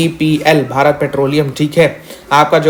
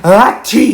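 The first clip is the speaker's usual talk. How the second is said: loud, higher imitated "achhi" sneeze; mock sneeze; 5 semitones higher, 4 dB louder, much slower than usual. Teacher acting out France sneezing for students, loud mock sneeze. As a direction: sneeze it out theatrically. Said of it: "France छींकता है".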